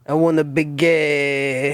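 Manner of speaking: mock French accent. Mimicking the French microphone.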